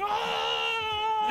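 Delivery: very high, drawn-out mock scream; imitated crowd scream. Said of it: "everyone lost their shit".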